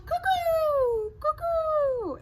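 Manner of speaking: high cuckoo-clock call. Acted. Reading the cuckoo's call to kids, high sing-song. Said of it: "The cuckoo called".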